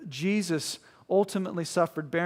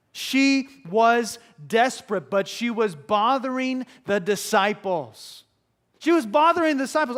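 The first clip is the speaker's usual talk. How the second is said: loud, raised pulpit emphasis; full-force preaching; 6 semitones higher, 7 dB louder than usual. Real, not acted. Preacher stressing her desperation to listeners, forceful. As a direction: hammer it out to the congregation.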